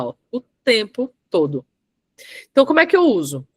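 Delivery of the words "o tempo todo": "o tempo todo" slowly, each word set apart; emphatic spacing. Shown as a demonstration. Coach repeating what she just used, emphatic spacing. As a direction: pause between "o tempo" and "todo", weighting both. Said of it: "Acabei de usar".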